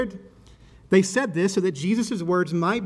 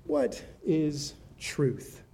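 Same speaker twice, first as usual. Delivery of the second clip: musing question; 6 dB quieter, much slower than usual. A narrator's reading. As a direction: let each word hang, slow and quiet. Pilate's weary question in the reading.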